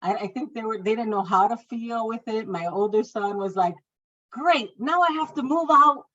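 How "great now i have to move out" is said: mock-sulky teen, pitched high and loud; sulky son. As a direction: grumble like a put-out teenager, high voice. Older son reacting to news.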